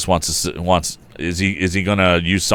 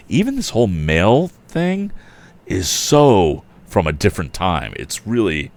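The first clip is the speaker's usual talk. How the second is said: ordinary delivery, nothing marked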